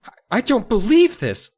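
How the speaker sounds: a lightly voiced upset girl; Mindy upset. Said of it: "Mindy cried".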